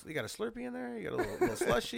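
high, laughing teasing question. Real, not acted. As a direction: giggle through the tease. Teasing friend about slushies.

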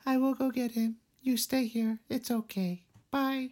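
high, monotone character imitation. Girl calmly leaving to go get him, monotone. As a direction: keep it high and evenly toneless. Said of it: "But she's so monotone".

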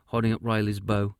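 ordinary delivery, nothing marked

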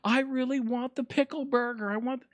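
high, whiny mock pleading; mock craving fan. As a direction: send up the internet begging for pickles. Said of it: "They're like".

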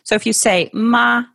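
"ma" pitched high and level; "ma" in the first tone, mother. Shown as a demonstration. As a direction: sing "ma" high and steady. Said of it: "that's mother".

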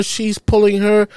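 ordinary talk, nothing marked

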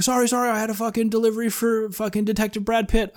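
high-pitched flustered driver; imitating panic in a high voice; mock panicked apology. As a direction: plead as a startled delivery guy. Acting out panicked delivery driver.

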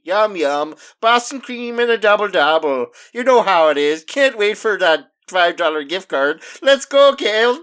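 hammed-up Canadian accent slipping into a leprechaun Irish lilt; mock Irish leprechaun accent. Cheering about the gift card prize, in a mock Canadian-turned-Irish accent. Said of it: "you started to become a leprechaun".

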